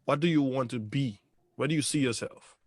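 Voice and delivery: usual speaking voice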